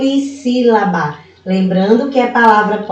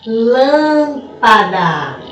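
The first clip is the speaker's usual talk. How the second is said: Lâmpada stretched slowly, stressing LÂM; stress on antepenultimate syllable; much slower than usual. Shown as a demonstration. Teacher demonstrates a proparoxytone to pupils, slow Lâmpada with stressed first syllable. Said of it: "a sílaba mais forte da palavra for a antepenúltima".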